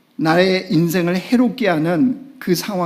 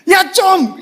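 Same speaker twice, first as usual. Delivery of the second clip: high, loud Gyeongsang 야, 좀; loud high Gyeongsang demand; Gyeongsang dialect; 5 dB louder, much slower than usual. Shown as a demonstration. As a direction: bark 야, 좀 the Gyeongsang way. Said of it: "이러면 뭐예요?".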